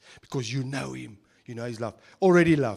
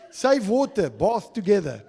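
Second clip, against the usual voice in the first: louder reveal, laughing at the end; loud punchline; 5 semitones higher, 9 dB louder than usual. Retold or imitated. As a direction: belt out the slogan with a laugh. Proud reveal of the joke.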